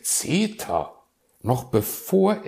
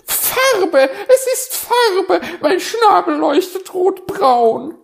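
very high, loud squawking wail; squawking magpie; 12 dB louder than usual. Acted. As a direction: screech it in laughing despair. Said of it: "die Elster krakeelte". (sehr hoch, laut krakeelende Elster)

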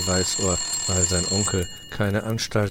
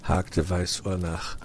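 ordinary delivery, nothing marked

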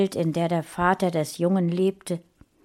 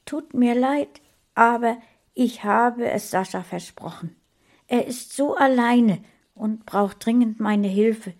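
higher, pleading gentle woman's voice; wife justifying herself to her husband; 5 semitones higher than usual. Acted. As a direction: explain yourself earnestly. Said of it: "rechtfertigte sich Mona".

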